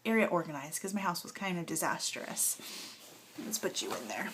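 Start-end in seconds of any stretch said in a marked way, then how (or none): 3.4-4.3 soft aside. Real, not acted.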